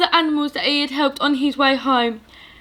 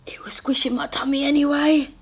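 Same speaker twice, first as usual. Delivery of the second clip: whispered aside; 2 semitones higher, 3 dB quieter, faster than usual. Acted. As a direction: gasp then a quick whisper. Giant's aside.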